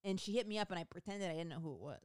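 ordinary talk

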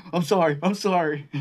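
high, loud panicked apology; pleading kid. Retold or imitated. A kid being slapped begs his dad, high and panicked.

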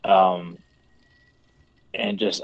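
normal talking voice

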